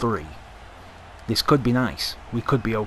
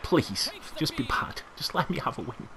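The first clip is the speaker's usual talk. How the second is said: quiet plea; 4 semitones higher, 10 dB quieter than usual. Real, not acted. Pleading with opponent's card.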